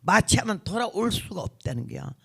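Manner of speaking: ordinary delivery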